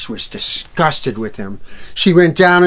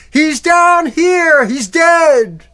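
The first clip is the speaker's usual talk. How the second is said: much higher, loud, slow call; yelling up; 7 dB louder, much slower than usual. Acted. Soldier yelling from the dungeon to the others. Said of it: "yelled up from the dungeon".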